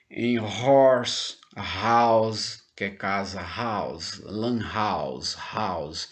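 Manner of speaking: "house" and "horse" with a breathy English h; aspirated h. Shown as a demonstration. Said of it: "tem um som aspirado".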